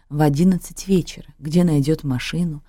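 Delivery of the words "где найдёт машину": ordinary delivery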